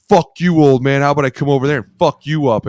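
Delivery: high, loud acted outburst; angry retold threat. Player mouthing off to the O-line coach, angry mock shout. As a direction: shout it like picking a fight.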